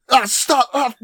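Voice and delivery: high yelping cry of pain; mock pained yelp. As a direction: yelp like you're being hit. Retelling 45 being beaten.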